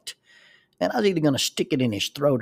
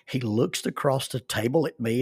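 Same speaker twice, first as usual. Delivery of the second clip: ordinary talk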